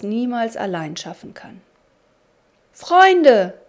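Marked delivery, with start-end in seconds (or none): 2.7-3.5 high, loud call of "Freunde". A narrator's reading. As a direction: call friends from across the house. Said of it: "rief er".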